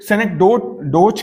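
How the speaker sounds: synecdoche said as "senek-doach"; variant pronunciation. Shown as a demonstration. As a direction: end synecdoche in one "doach" syllable, with no final "kee". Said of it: "कहते हैं कुछ लोग".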